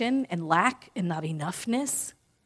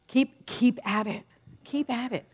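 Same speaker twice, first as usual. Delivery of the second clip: inner cheerleader; 3 semitones higher than usual. Pretend. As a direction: urge them on gently. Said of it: "what does the inner cheerleader say".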